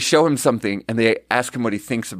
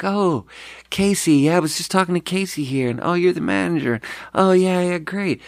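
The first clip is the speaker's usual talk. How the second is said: higher, mock sugary customer voice; fake-cheerful lady; 6 semitones higher than usual. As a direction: play the overly happy bar guest, sweet on top. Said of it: "And she's like".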